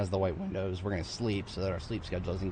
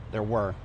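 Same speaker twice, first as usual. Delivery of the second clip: "were" hit louder and falling; stress on WERE; 5 dB louder than usual. Shown as a demonstration. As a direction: punch the word "were". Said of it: "Emphasis on were".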